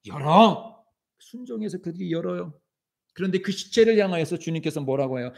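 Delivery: impassioned sermon, rising and falling; preaching. Acted. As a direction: preach it with fervor to the congregation. (열정적인 설교)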